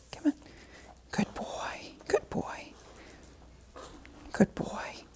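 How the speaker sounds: soft whispered praise for a dog; cooing to a scared pet. Real, not acted. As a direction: hush and soothe a nervous dog. Soothing a nervous dog.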